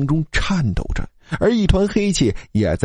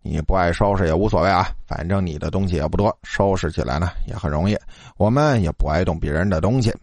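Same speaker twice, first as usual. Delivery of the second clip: low, softened, offhand needling; unhurried, nonchalant; 6 semitones lower than usual. A narrator's reading. Roommate shrugging off the cleanup, low and nonchalant. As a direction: toss it out idly, pitched low. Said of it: "老二悠悠地说".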